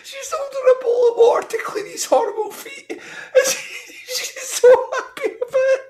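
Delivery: high, squeaky laughing voice; cracking up mid-sentence; helpless laughter. Real, not acted. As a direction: squeeze the words out through real laughter. Helpless laughter while retelling scene.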